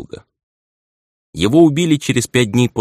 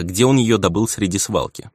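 usual speaking voice

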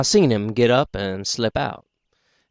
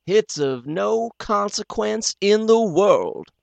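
higher, slow, dismissive; slow and insulting; 10 semitones higher, slower than usual. Acted. The doctor dismissing Will's name, slow and insulting. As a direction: let every word insult him. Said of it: "very slow and insulting".

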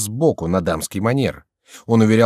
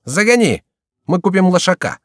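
high, loud and careless; offhand reply in a raised voice; breezy dismissal; 10 semitones higher, 4 dB louder than usual. A narrator's reading. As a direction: call back without any sympathy. Chicot callously brushes off the complaint.

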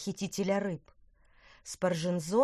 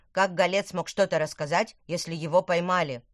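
insistent questioning; 6 dB louder than usual. Acted. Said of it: "продолжал допытываться Тыквер".

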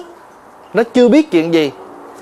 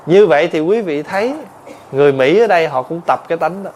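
ordinary talk, nothing marked

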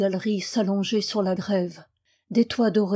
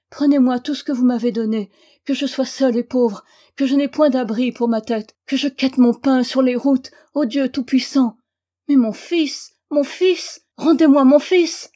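desperate plea; 4 semitones higher, 6 dB louder than usual. A narrator's reading. Desperate prayer for her son.

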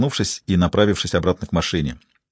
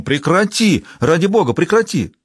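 higher, urgent call to stop; alarmed cry; 7 semitones higher than usual. A narrator's reading. Father crying out to his daughter to stop, alarmed. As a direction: cry out to break up the fight. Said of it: "как отец воскликнул".

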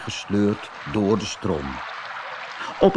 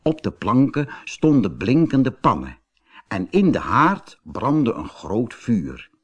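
ordinary delivery

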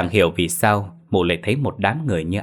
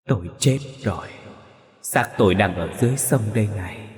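sobbing ghost. A narrator's reading. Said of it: "Mụ Hường nấc lên".

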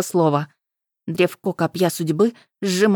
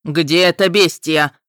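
booming, louder and slow; 5 dB louder, slower than usual. A narrator's reading. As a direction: thunder it out like a god. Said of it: "громогласно произнёс верховный ас".

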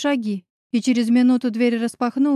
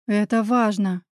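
plain reading voice

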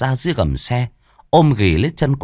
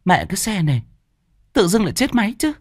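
higher-pitched exclamation of pretend annoyance; feigned complaint; 10 semitones higher, faster than usual. A narrator's reading. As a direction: cry out as if the bike just broke down. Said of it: "cô giả bộ kêu lên". (giả bộ kêu ca, cao giọng)

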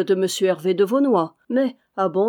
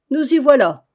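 raised, higher voice cutting in; pitched up and projected; loud interjection; 5 semitones higher, 7 dB louder than usual. A narrator's reading. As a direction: speak up to be heard, interrupting. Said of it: "interrompit Lapierre assez haut pour être entendu".

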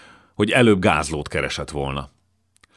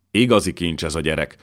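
plain reading voice

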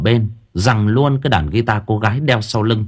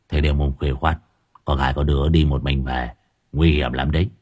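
low, soft gruff warning; low gangster voice, gentle; 8 semitones lower, 4 dB quieter than usual. Acted. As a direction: gently warn the girl in a gruff voice. Gangster gently warns girl. (giọng trầm khàn nhưng nhẹ nhàng)

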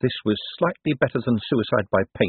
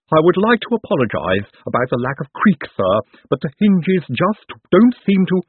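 higher, deferential character voice; apologetic Igor servant; 7 semitones higher, 5 dB louder than usual. A narrator's reading. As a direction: apologise formally as an odd henchman.

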